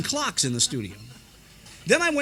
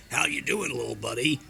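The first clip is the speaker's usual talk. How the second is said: Chopper cartoon voice; 4 semitones lower than usual. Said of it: "Chopper says".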